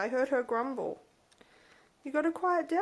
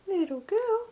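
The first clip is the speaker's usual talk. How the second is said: higher cooing repeat; 8 semitones higher than usual. Real, not acted. Owner cooing at her bird, higher repeat. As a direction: say it again way up high.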